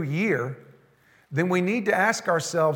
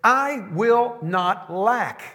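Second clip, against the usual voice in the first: slow, weighty reading; 3 semitones higher, much slower than usual. Shown as a demonstration. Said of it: "what literally that means is".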